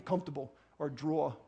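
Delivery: low, soft New York accent. Shown as a demonstration. Preacher joking about his slipping speech, New York accent. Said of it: "my New York accent comes back in".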